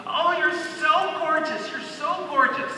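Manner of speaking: high gushing mother voice, slow; doting mother. Retold or imitated. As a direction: gush over them like a doting mom. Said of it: "grabs them by the cheeks and goes".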